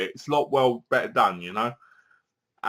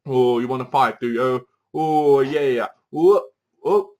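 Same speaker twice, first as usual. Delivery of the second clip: hammed-up taunting fighter with whoops; mock fighter; 4 dB louder than usual. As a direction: goad him into a mock fight. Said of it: "he goes like this".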